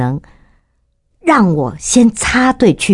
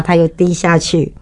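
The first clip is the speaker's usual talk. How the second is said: normal talking voice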